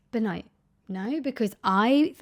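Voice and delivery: usual speaking voice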